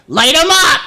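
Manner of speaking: high shouted cop order, mocking; sneering imitation of a cop yelling. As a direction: bark it like a trigger-happy cop. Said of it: "the little fucking piggy who's like".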